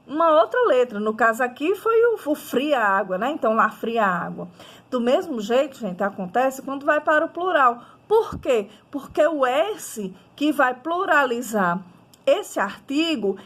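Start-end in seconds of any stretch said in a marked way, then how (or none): none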